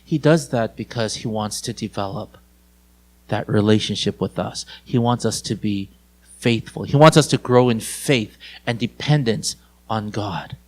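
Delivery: low, soft sermon voice; hushed, earnest preaching. Real, not acted. Preacher explains to listeners why God whispers, low and hushed. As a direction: bring it right down, softly earnest.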